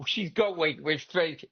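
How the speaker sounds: ordinary talk, nothing marked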